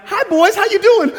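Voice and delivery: very high, loud sugary greeting; sweet auntie. Retold or imitated. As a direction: greet the boys brightly as the auntie. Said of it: "Auntie Linda's like".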